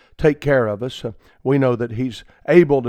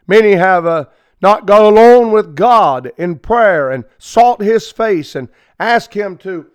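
higher and louder, hammering each Many have; fervent preaching; 6 semitones higher, 6 dB louder than usual. Acted. Radio preacher rousing his listeners, fervent preaching. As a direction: preach it with rising fire. Preacher builds to full force.

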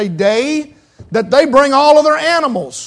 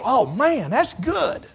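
imitated pleased congregation; 2 semitones higher, 8 dB quieter, slower than usual. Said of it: "everybody goes".

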